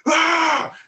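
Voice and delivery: high-pitched mock scream; imitated scream. Storyteller screaming at a charging sow, high mock scream. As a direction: let out a high yell, reenacting panic. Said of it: "And I was screaming".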